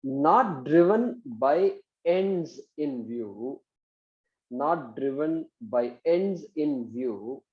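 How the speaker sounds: very slow, spaced-out dictation. Shown as a demonstration. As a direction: dictate slowly for students to write.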